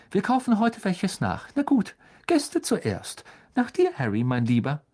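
high, sing-song motherly voice; a kind mother ushering the guest first; fussing mother. A narrator's reading. Mrs Weasley voiced by narrator.

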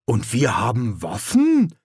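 wistful, hopeful question; yearning query. A narrator's reading. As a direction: ask longingly, lifting on the last word. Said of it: "erkundigte sich Knaller sehnsüchtig".

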